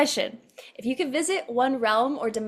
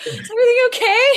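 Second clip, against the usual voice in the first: mock concerned; 13 semitones higher, 10 dB louder, faster than usual.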